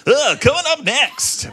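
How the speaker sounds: slow, high mock radio announcer. Student showing off his future radio voice, as a mock announcer. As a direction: show off the radio voice, hammed up. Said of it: "I got this sweet-ass voice".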